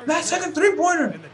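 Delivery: very high, hammed-up squeal of glee; gleeful celebration. Imitating a player after his last-second three-pointer, gleeful. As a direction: mimic a player gleefully boasting. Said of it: "you see him gets all happy".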